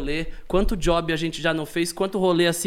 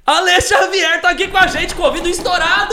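excited announcer; 11 semitones higher, 7 dB louder than usual. Acted. Announcing guest loudly.

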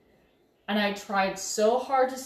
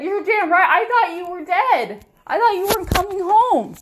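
much higher and louder angry yelling; shouting at her fiance, fear turned into rage; furious shout; 9 semitones higher, 8 dB louder than usual. Retold or imitated. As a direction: let him have it, shouting at fiance. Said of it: "I told him how angry I was".

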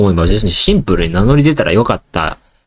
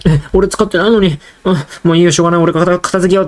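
higher, a hint of a sighing child giving in; resigned kid; 7 semitones higher, faster than usual. Child deciding to tidy anyway, lightly resigned. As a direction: shrug it off like a good little kid. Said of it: "って思ってて".

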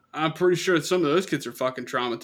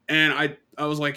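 ordinary delivery

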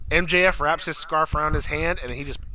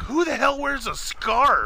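mocking Jericho; 9 semitones higher, slower than usual. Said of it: "He's like".

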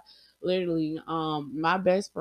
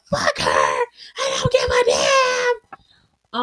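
crying angry outburst; 6 dB louder than usual. Retold or imitated. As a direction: voice cracking high into a sob, slow. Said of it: "real tears, real pain, real hurt".

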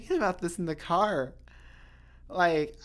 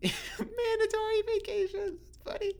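laughing. Real, not acted. Amused at her vacation.

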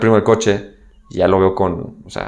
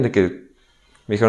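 ordinary talk

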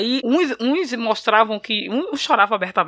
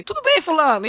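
higher, hearty mock greeting; put-on cheeriness; 7 semitones higher than usual. Uncle hides grief at the funeral, put-on cheeriness. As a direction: greet as if nothing happened.

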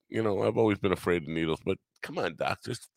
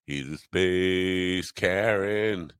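drawn out, slow punchline; slow emphatic delivery; much slower than usual. Shown as a demonstration. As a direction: land the joke slowly. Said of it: "Bet you weren't ready for that".

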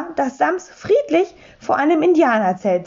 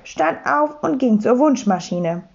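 plain reading voice

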